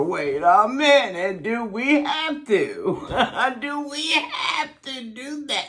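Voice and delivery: put-on high, laughing kid's whine; childish protest. Child protesting to mom about her nickname, high and laughing. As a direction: plead like a little girl caught out. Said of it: "I just remember".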